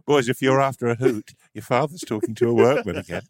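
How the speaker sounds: a hint of a gleeful parent summoning; mild teasing announcement to the sons; mock call to the boys.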